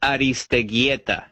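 Aristeguieta slowly, in Spanish, ah-rees-teh-GYEH-tah; surname pronunciation. Shown as a demonstration. Guest showing hosts his surname, Aristeguieta slowly in Spanish. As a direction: tap the r in Aristeguieta and stress GYEH. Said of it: "pronounce your last name".

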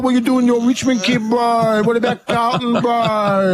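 high-pitched lad voice; gym guy impression. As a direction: ham up the gym bro teasing. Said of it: "a guy coming".

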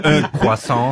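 "un croissant" said the French way, kwah-sahn; French accent on "un croissant"; French pronunciation. Shown as a demonstration. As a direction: order "un croissant" like a Parisian. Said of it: "do it how you did it this morning".

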